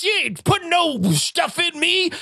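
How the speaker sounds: lampoon a defiant anti-vaxxer yelling back; mock anti-vaxxer. ER patient refusing the tetanus shot, mocking high indignant shout.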